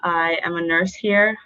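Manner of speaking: ordinary talk, nothing marked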